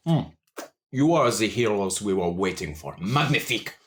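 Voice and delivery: a hint of a smooth French charmer; French-accented orc. GM plays Le Chat.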